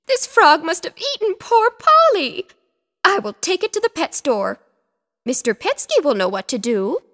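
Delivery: put-on fretting old lady. A narrator's reading. Missus Picasso fearing the frog ate Polly, worried. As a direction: fuss like an anxious pet owner. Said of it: "cried Missus Picasso".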